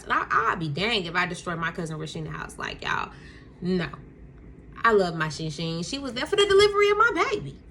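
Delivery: ordinary talk, nothing marked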